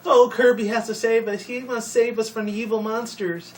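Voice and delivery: high-pitched mock damsel pleading for rescue; imitating a distressed cartoon sister. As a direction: put on a high, hammed-up helpless heroine voice. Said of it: "sounded like the damsel in distress".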